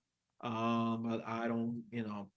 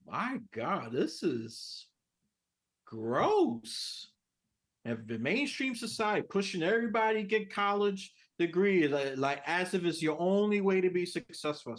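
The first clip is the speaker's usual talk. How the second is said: disgusted; 7 semitones higher, 5 dB louder than usual. Real, not acted. Disgusted rant about college.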